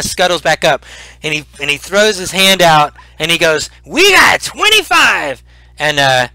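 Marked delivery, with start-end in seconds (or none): 3.9-5.4 high, slow, drawn-out call; auctioneer's shout. Acted. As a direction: shout the bid out to the whole crowd. Auctioneer scrambles up, shouts bid.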